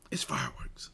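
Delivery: breathy hushed aside, voice dropped; whisper. Real, not acted. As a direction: drop to a secret whisper for the punchline. Teasing a dramatic story.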